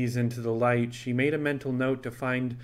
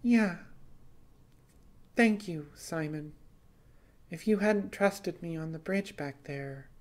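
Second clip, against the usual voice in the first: put-on higher, lighter young woman's voice, grateful; grateful Scylla, voiced higher than the narration; Scylla's voice; 6 semitones higher, 4 dB quieter than usual. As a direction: thank him softly as Scylla.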